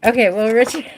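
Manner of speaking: ordinary delivery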